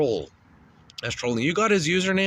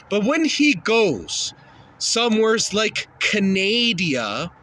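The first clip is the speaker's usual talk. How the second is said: ordinary talk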